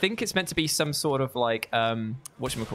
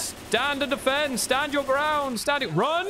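mock commander; 8 semitones higher, slower than usual. Game rallying cry.

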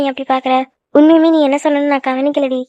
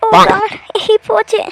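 high startled gasp of dismay; 7 semitones higher, much slower than usual. Acted. Girl dropped by the brother cries out in high dismay. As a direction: yelp as she is dropped.

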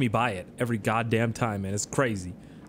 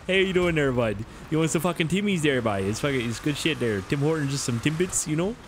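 hammed-up Canadian hoser talk; Canadian accent. Welcoming everybody to Canada, mock Canadian accent. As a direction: put on a thick Canadian accent. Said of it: "We don't all sound like that".

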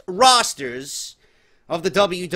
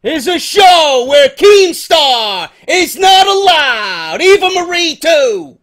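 loud, drawn-out hype host shout; hype announcer intro; 6 semitones higher, 10 dB louder, much slower than usual. Acted. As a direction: bellow it like a wrestling announcer. Hyped show intro announcement.

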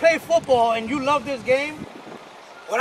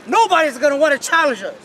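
pep speech; 2 semitones higher than usual. Real, not acted. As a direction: fire them up. Coach firing up team.

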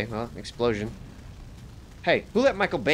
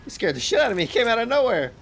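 startled scream, then shaky laugh; jump-scared shriek settling down; scream into giggles; 14 semitones higher, 3 dB louder than usual. Real, not acted.

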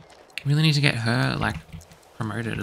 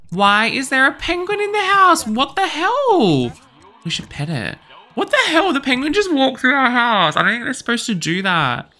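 very high, loud astonished squeal; baffled high-pitched outcry at a penguin indoors; shocked shriek; 12 semitones higher, 8 dB louder than usual. Real, not acted. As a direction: scream in disbelief at the penguin. Penguin walks through house.

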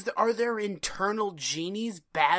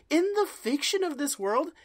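ordinary delivery

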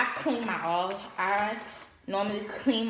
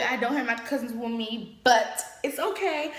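ordinary delivery

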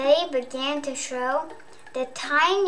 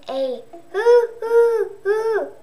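rising, louder owl hooting; owl hoots; 6 semitones higher, 4 dB louder than usual. Acted. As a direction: hoot it like an owl in the dark trees. Reader imitates the owl's hoots.

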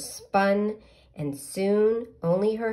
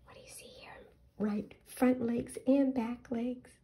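whisper; 6 semitones higher, 6 dB quieter than usual. Real, not acted. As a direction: whisper it like a secret. Reader asks children.

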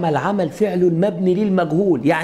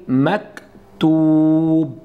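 مكتوب stretched very slowly with a falling end; slow dictation; 2 semitones lower, much slower than usual. Shown as a demonstration. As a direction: draw out مكتوب for the class. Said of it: "اقول لك ايه؟".

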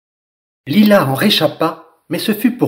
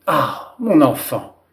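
a touch of storybook sighing; slower than usual. A narrator's reading. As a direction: let a mild sigh carry the words. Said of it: "soupira-t-il".

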